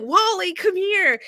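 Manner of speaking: urgent call for the cat. Retold or imitated. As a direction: call out into the woods. Calling her cat again.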